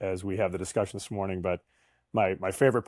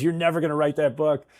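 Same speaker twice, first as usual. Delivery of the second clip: higher, louder teasing jab from the wife; 6 semitones higher, 5 dB louder than usual. Retold or imitated. Wife mocking his book plans, higher and louder teasing. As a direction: needle him like his wife did, laughing at him. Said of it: "And she goes".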